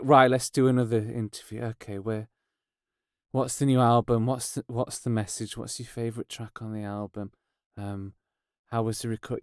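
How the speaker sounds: low, very soft mock interview drone; dreary routine questioning. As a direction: run through stock questions under your breath. Voices musicians' dreary round of interview questions.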